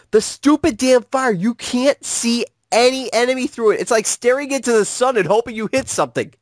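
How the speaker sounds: high-pitched, exasperated venting; exasperated rant. Real, not acted. Gamer venting to a friend about blinding fire, exasperated rant. Ranting about an infuriating game mission.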